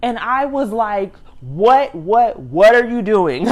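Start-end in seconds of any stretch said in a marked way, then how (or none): 1.4-3.5 loud, slow, drawn-out disbelief; shocked. Retold or imitated. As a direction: re-enact your shock, stretching each word loudly. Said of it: "Like, I was in shock".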